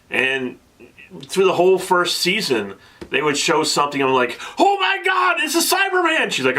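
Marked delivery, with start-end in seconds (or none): 4.6-6.3 much higher, shouting out the monster's name; excited fanboy squeal. Retold or imitated. Husband to wife during the show, excited high shout. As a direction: squeal with delight at husband's telly reveal. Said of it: "I was like all excited".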